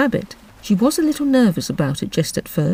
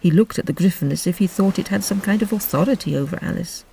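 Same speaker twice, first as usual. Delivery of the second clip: usual speaking voice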